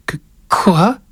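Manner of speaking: put-on little boy's high, stammered question; slightly quavering. A narrator's reading. Boy reacts to grandfather's errand, slightly quavering. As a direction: stammer it as an alarmed child. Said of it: "la voix un peu vibrante".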